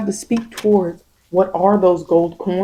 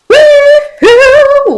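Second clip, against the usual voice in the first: real cheer, much higher and louder; excited whoop; 13 dB louder than usual. Real, not acted. Reader celebrating the card she pulled with a loud whoop.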